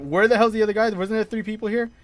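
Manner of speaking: normal talking voice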